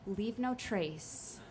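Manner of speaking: soft, slow murmur to herself; quiet self-repeated mantra. Retold or imitated. Alone in her tent, telling herself softly, slow. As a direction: lull yourself with the words.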